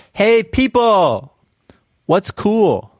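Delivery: high, drawn-out greeting call; stretched, high-pitched hail; hailing a crowd. Acted. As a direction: call out cheerily to a whole crowd. Character hails the crowd.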